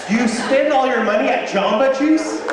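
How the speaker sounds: high loud incredulous question; disbelieving coach. Retold or imitated. Coach to student who spent everything, high loud disbelief. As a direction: push it up in disbelief. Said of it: "I'm like".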